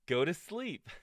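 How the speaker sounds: high, loud shout through giggles; shouting, laughing. Acted. Toad rereading the last list item, giggly shout. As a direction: yell it with a grin. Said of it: "shouted Toad".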